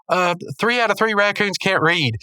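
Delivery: high-pitched cartoon guess; Pops guessing. Pops guessing Rigby's charade, high put-on voice. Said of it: "He goes".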